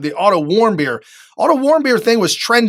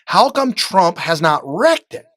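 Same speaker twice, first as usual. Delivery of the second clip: normal talking voice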